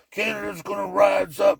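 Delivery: put-on rallying cry; mocking rabble-rouser voice; mock propaganda. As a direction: parody a rousing slogan in a strained shout. Mocking media propaganda slogan.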